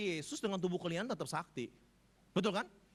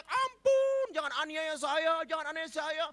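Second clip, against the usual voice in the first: a shrieking, pleading demon, high and loud; demon begging; 9 semitones higher, 6 dB louder than usual. Retold or imitated. The demon begs Jesus not to torment it, shrieking. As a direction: cry out for mercy, terrified. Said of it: "setannya teriak-teriak".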